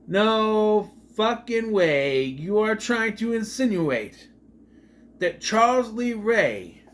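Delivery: high, loud, drawn-out incredulity; slow, hammered retold outburst; emphatic disbelief. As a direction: stretch every word in outraged disbelief. Incredulous reaction to a plot detail.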